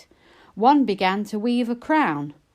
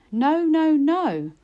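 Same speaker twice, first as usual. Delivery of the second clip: no no no, raised and higher; a touch of alarm in the refusals; firm refusal; 7 semitones higher, slower than usual. A narrator's reading. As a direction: push the goblins away with each no. Lizzie refusing firmly.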